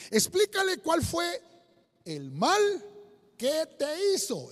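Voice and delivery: loud, declaimed Bible verse in separated phrases; preacher's emphatic reading with long pauses; solemn scripture reading. Acted. Reads Bible verse aloud.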